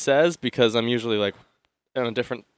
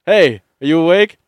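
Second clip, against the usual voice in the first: loud, raised check-in call; 4 semitones higher, 11 dB louder than usual. Retold or imitated. Checking if his girlfriend is awake, a loud call. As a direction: raise your voice to wake her. Calling to the silent sleeper.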